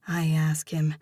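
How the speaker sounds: usual speaking voice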